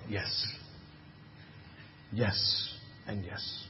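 slow, soft whispered affirmation; whispered yes. Acted. Preacher softly answering his own question, whispered. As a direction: breathe the yes out gently.

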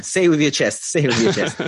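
punchy, playful heckle over the other speaker; loud goading. Real, not acted. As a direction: egg your co-host on, loudly. Teasing co-host after a hedged joke.

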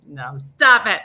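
ordinary talk, nothing marked

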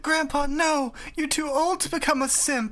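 high melodramatic plea; mock horrified Yugi. Yugi pleads with turning grandpa, high mock horror. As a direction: wail it like a cartoon hero.

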